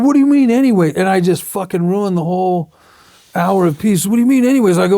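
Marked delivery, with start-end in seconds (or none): 0.0-0.9 retold yell, higher and louder. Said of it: "And he yelled at me". 4.1-4.8 retold yell, louder and higher. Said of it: "And he yelled at me".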